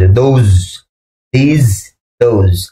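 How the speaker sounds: "those" and "these" said clearly with a voiced opening sound; teacher modelling "these" and "those" one word at a time; careful pronunciation. Shown as a demonstration. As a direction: say "those" slowly, stressing its first sound. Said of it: "উচ্চারণ হবে বর্গীয় জ".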